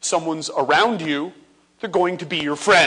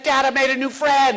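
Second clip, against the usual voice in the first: put-on excited kid; 7 semitones higher, 4 dB louder than usual. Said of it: "comes back to me all excited".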